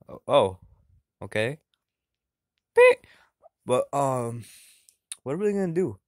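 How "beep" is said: very high, loud electronic beep; high beep sound. Pretend. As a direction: squeak out a beep. Imitating a beep.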